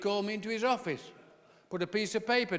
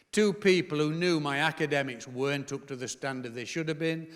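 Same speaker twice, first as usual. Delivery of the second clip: usual speaking voice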